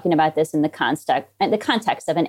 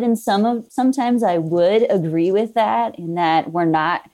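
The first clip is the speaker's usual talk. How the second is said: ordinary talk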